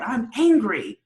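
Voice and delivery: high, loud mock outburst; retold yell. Co-host quoting Joe's outburst in the film, retold yell. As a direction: blurt it out like his frustrated yell. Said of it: "And he just yelled".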